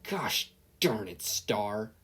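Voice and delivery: slow exasperated grumble; bury your face and grumble; groaning president. Acted. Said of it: "President DeVito groaned".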